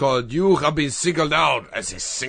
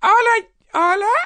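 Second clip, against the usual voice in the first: put-on baby voice; 6 dB louder than usual. Squeaky baby voice on prank call.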